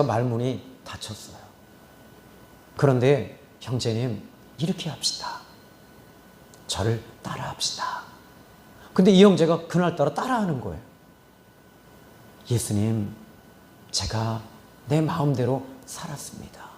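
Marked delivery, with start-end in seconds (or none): 3.6-8.0 whisper. Retold or imitated. Pastor whispering prayer guidance bedside. 12.5-16.6 whisper. Retold or imitated. Patient's prayer retold in whisper.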